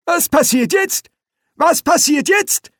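put-on very high, insistent old man's voice; agitated old wizard. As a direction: ask twice, piping and rising. Old Poons demands to know.

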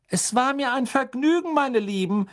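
high-pitched motherly voice, cordial; motherly hostess. A narrator's reading. Misses Weasley answering the children's thanks, motherly and cordial. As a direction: play the fond mother in a high voice.

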